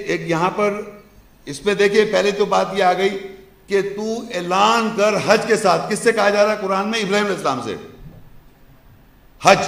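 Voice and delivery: normal talking voice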